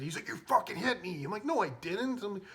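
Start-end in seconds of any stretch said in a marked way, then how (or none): 0.3-1.2 high-pitched, outraged accusation; pissed off. Retold or imitated. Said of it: "He got pissed too". 1.4-2.2 high-pitched mock denial; feigned innocence. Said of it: "pretending like it was an accident".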